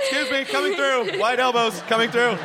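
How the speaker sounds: high-pitched, hurried calls; jokey, high-voiced excuse-me; mock crowd-pusher. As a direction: play at elbowing through a crowd. Acting out pushing through crowds.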